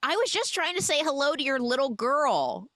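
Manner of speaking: high, earnest mock reply; imitated girl character. Girl answering the voice behind the door, imitated in a high voice. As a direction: pitch it up as a girl explaining herself. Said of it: "And Bee responds".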